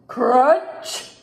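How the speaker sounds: loud, punched-out crunch rising at the end; crunch sound effect. A narrator's reading. Moose bites into a carrot, loud crunch. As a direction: make the word crack like a big bite.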